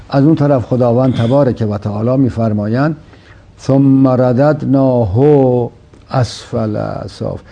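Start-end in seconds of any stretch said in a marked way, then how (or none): 3.6-7.4 Quran recitation. Acted. Host quoting the Quran verse to the guest, slow solemn recitation.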